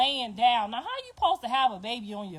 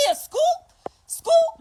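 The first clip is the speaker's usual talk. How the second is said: much higher, louder rising yell; mock boyfriend gang call; 9 dB louder, much slower than usual. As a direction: join the chant like the dad. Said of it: "He like".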